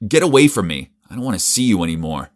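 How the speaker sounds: loud, angry rejection; disgusted. Acted. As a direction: push her away with disgust. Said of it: "but I was disgusted".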